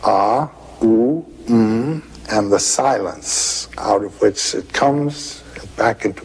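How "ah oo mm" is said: "Ah, ooh, mm" as three drawn-out sounds of om; AUM sounded out. Shown as a demonstration. Scholar demonstrating the om syllable to his interviewer, sounding it out. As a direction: sound out "Ah, ooh, mm" one part at a time. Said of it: "What is the fourth element?".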